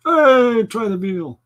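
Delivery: high, loud Borscht Belt line; mock comedian voice. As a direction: toss off a corny comedian line. Comic high mock announcer line.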